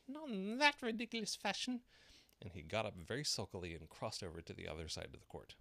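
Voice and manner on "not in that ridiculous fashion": very high, squeaky little voice; prim little mouse retort; dormouse voice. A narrator's reading. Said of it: "the Dormouse".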